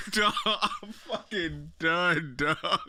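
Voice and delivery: worn out from cracking up; laughing. Real, not acted. Cracking up at the clip.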